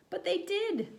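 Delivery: put-on high, pleading boy voice; high childlike protest; insistent boy. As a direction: insist like a kid caught out.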